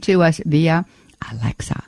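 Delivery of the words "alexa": low, hushed near-whisper. Real, not acted. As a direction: drop to a whisper so the device won't hear. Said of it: "I have to whisper that".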